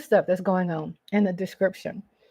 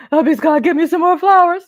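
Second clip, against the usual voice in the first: laughing; 7 semitones higher, 7 dB louder, faster than usual. Real, not acted. Laughing about husband's flowers.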